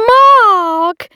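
loud, high call across the room. A narrator's reading. Hostess calling Mark over, loud and high. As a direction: sing out his name to get attention. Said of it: "said Una".

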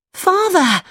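high, joyful call to father; excited cry. Acted. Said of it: "he cried".

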